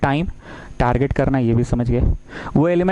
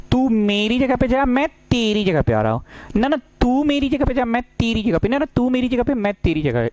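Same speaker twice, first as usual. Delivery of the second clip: higher, playful back-and-forth between two rows; hammed-up swap argument, the "ना, ना" pitched up; playful rows bickering; 7 semitones higher than usual. Rows argue over swapping places, playful and higher. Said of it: "interchange का मतलब होता है".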